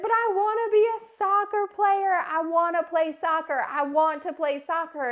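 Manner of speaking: put-on high, pleading kid voice, crying; a little girl protesting through tears; five-year-old begging to keep playing soccer; tearful child. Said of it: "She's like".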